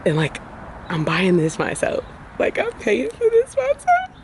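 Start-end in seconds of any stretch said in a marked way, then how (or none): 2.4-4.1 choked-up high squeak of emotion; overwhelmed, on the verge of tears; near tears. Real, not acted. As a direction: voice cracking high, close to crying. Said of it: "guys, I could cry".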